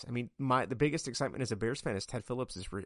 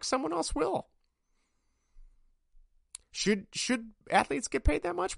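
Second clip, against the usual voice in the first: much higher and louder, worked-up tirade; heated rant; 14 semitones higher, 3 dB louder than usual. Real, not acted. Podcaster defending Fields against fans: heated high rant. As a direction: fire back at the critics, voice way up and pushing.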